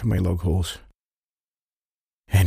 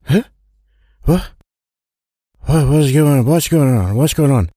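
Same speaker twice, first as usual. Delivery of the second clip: louder, higher panicked questions; startled and confused; 4 semitones higher, 4 dB louder than usual. Acted. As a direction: jolt awake in confusion. Woken up suddenly, confused.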